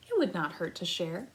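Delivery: ordinary delivery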